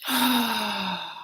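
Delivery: long, falling sigh; drawn-out exhale of dismay. Real, not acted. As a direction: let out a heavy breath. Speaker sighs over the situation.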